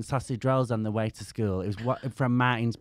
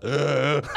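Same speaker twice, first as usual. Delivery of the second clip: disgusted groan; 5 dB louder than usual. Retold or imitated. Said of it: "And I was like".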